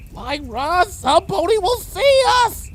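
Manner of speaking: high, frightened pleading voice; whimpering. Acted. Bon Bon whimpering to Lyra about being seen. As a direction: plead in panic. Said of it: "Bon Bon whimpered".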